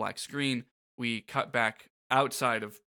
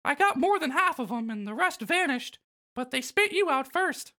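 Mickey Mouse voice; 13 semitones higher than usual. Acted. Voicing Mickey in dialogue.

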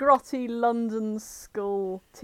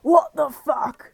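slow, louder hard-man "What the fuck?"; Vinnie Jones voice; 5 semitones higher, 7 dB louder, slower than usual. Acted. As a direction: drag it out like a tough guy. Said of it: "voiced Nick as if he was being played by Vinnie Jones".

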